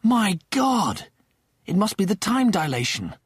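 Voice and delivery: high-pitched astonishment; stunned realisation. Acted. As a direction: work it out aloud in shock. Rimmer realises what has happened.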